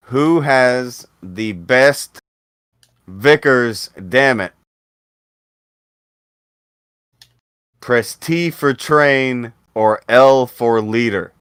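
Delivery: slow, loud announcing call. Real, not acted. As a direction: belt it out like a game show host. Host calling out vote prompt.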